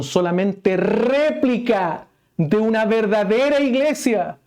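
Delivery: high, loud, impassioned preaching; preaching at full force. Acted. Preacher driving home the mark of a true church, full force. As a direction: proclaim it to the whole congregation.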